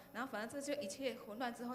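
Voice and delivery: usual speaking voice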